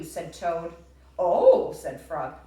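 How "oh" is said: loud high oh; a big delighted oh. Acted. Teacher voicing Frog reacting to the list, loud and high.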